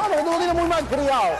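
mock scolding wife, voice lifted. Wife scolds husband in story.

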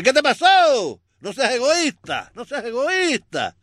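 put-on high, loud Italian accent; slow, hammy Italian-accented Pope. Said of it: "y el Papa le dice".

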